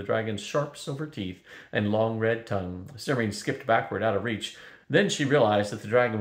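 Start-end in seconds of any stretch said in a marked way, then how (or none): none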